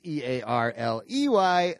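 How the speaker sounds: "E A R L E Y" said letter by letter; spelling it out. Shown as a demonstration. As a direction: spell "E A R L E Y" one letter at a time. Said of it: "And Early is".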